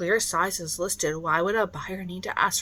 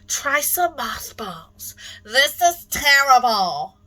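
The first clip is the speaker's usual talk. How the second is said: high, loud, slow melodrama; hammy stage actor; 4 semitones higher, 4 dB louder than usual. Pretend. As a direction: ham up the disgust for the stage. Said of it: "I'll be accepting Academy Award nominations".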